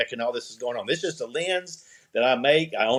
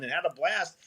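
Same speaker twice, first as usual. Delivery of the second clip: ordinary delivery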